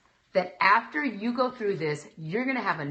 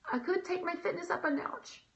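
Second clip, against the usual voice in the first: dictated idea; 10 semitones higher, 3 dB quieter than usual. Retold or imitated. Acts out dictating ideas into phone.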